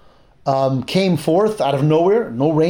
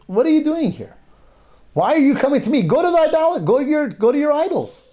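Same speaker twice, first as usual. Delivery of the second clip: high, sharp and dismissive; scornful rebuff; 9 semitones higher than usual. Retold or imitated. Elisha scolding the idol-worshipping king, high and scornful. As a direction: brush him off with contempt.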